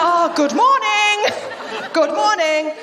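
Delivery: slow, high, drawn-out sarcastic greeting with a laugh; mock-cheery greeting to an alarmist mum; sarcastic good morning. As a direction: stretch out the fake-polite good morning. Sarcastic reply to mum's call.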